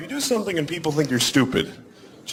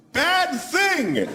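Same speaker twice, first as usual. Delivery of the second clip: mock yell; 11 semitones higher than usual. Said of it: "so I just yelled out".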